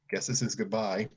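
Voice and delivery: ordinary delivery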